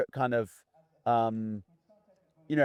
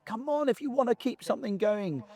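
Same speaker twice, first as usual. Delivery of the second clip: higher-pitched exasperated protest; exasperated appeal; 8 semitones higher, 3 dB quieter than usual. Real, not acted. Protesting at attitudes that dishearten the team, exasperated and high. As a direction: plead with them to see sense.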